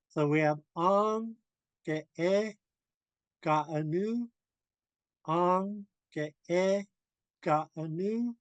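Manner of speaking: ong, ke e, ka anu, every tone mark hit; Haida tone drill. Shown as a demonstration. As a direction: drill the three words, hitting each tone. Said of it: "Notice we're hitting all the tone marks".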